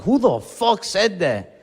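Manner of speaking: hammed-up confrontational comeback; retold angry challenge. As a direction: demand it slowly, acting mad. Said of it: "it made me mad".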